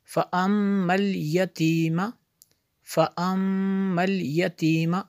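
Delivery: फ़अम्मल with the doubled m held as a long hum; ghunna. Shown as a demonstration. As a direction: linger nasally on the m of फ़अम्मल. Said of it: "मीम शद्दत गुन्ना होगा". (फ़अम्मल की मीम पर गुनगुनाता ठहराव)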